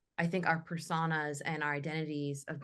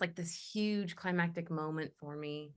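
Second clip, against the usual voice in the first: ordinary talk, nothing marked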